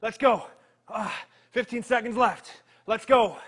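ordinary talk, nothing marked

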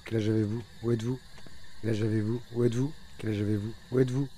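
normal talking voice

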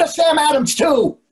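finally losing patience over the beer; exasperated shout. Real, not acted. Husband fed up with the waiter, shouting his order.

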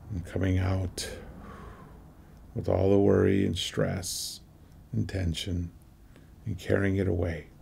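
slow meditation voice with long pauses; measured, spacious meditation leading in short phrases; guided meditation. Acted. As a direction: guide listeners through each breath, unhurried, letting phrases settle.

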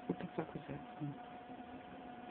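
hushed, slow whispered question; startled whisper. Real, not acted. Hearing something odd in the room, whispering. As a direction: whisper it, spooked by a sound.

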